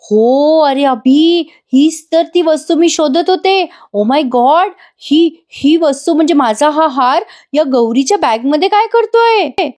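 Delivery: high, sugary mock astonishment; put-on wide-eyed gasp of surprise, pitch climbing to the end; fake surprise. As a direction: overplay the innocent shock as the scheming woman. Ragini feigns surprise at necklace.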